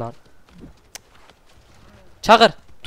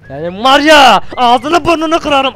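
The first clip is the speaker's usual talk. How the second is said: slow, loud, higher raging threat; furious threat; 7 semitones higher, 7 dB louder, slower than usual. Real, not acted. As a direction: spit the threat out in fury. Arkadaşına saldıran düşmana kızıyor. (öfkeli, yavaş tehdit bağırışı)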